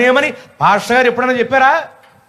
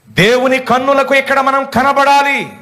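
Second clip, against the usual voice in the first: high, declamatory sermon cadence; forceful preaching; 5 semitones higher than usual. Real, not acted. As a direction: proclaim each line slowly and high. (ఉద్వేగంగా, హెచ్చు స్వరంతో ప్రబోధం)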